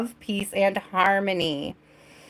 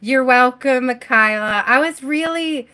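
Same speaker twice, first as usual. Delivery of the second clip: normal talking voice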